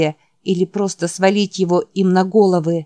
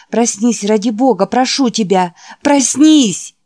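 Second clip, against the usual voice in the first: raised pleading that builds to a shout; urgent coaxing; 4 semitones higher, 5 dB louder than usual. Acted. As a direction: plead with him to wake, louder at the end. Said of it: "уговаривая". (настойчивая мольба, переходящая в крик)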